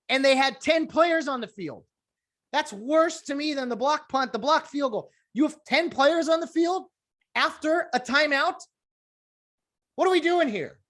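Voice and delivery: high, incredulous disbelief at the blunder; exasperated rant. Real, not acted. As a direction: pitch up in outrage at ten men on the field. Ranting about Packers' ten-man blunder.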